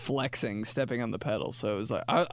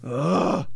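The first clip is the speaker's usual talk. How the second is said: disgusted groan; 4 semitones higher, 6 dB louder than usual. Pretend. Disgusted at dog photos.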